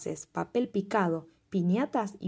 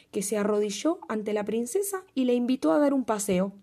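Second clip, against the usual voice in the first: usual speaking voice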